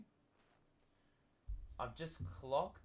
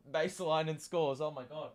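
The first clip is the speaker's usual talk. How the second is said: voice cracking; 4 semitones higher, 7 dB louder than usual. Real, not acted. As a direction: voice cracks on a loud excited call. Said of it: "Where did my voice go?".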